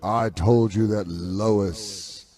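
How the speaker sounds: low, slow put-on grandpa voice; lightly voiced stern grandfather; mock disapproving grandfather. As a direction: growl it out like a disgusted old patriarch. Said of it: "He goes".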